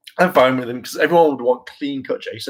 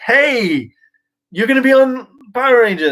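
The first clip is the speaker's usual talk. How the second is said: higher, louder cheerful announcement; dream email; 11 semitones higher, 5 dB louder than usual. Pretend. Imagined casting email read in a higher, excited voice. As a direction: announce the good news brightly. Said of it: "it was just like".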